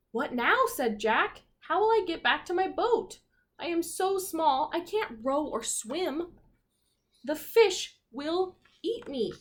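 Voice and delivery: understated frightened little captain; mildly worried pirate. Acted. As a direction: fret like a tiny lost sailor. Scared little pirate voiced.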